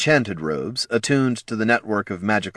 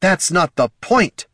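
slow, loud, high-pitched retort; exasperated, forceful snap; angry growl; 10 semitones higher, 5 dB louder than usual. Acted. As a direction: growl it out, each word drawn. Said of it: "growled the Solomancer".

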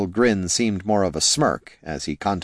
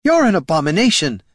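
high, loud condemnation; outraged; 10 semitones higher, 6 dB louder than usual. A narrator's reading. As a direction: spit it out in outrage. Priest condemns the lich.

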